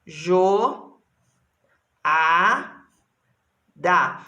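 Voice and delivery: Jo, a, da each said alone with pauses; sílabas separadas; syllable by syllable. Shown as a demonstration. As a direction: break the word into its syllables for kids. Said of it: "Quantas sílabas tem essa palavra?".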